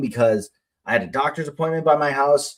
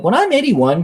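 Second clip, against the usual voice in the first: lightly imitated daughter, pitched up; a hint of a hopeful kid's higher voice; child asking; 9 semitones higher than usual. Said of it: "but she'll be like".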